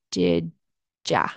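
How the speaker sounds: "Did ya?" dropped very low, casual "ya". Shown as a demonstration. Teacher showing friends' casual "Did ya?". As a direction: let "Did ya?" fall low and loose. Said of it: "that's why it turns into".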